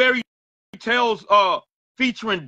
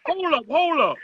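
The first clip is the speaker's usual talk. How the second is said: higher, urgent hold up; excited hold up; 7 semitones higher than usual. Real, not acted. Host stopping the song to replay it, excited call. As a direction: halt everything, hyped.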